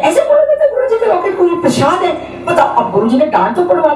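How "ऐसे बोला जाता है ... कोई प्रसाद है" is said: laughing mock scolding. Uncle scolds, retold laughing.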